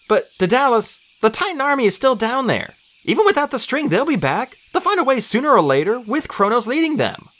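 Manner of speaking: high, anxious pitch; urgent warning. A narrator's reading. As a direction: warn of the coming army. Urgent warning about enemy army.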